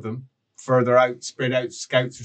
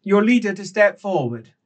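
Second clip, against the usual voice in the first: higher, raised voice; calling out; 7 semitones higher than usual. Acted. Magritte calling out for the cultist leader, raised voice. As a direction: demand it across the cavern. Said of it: "and then calls out".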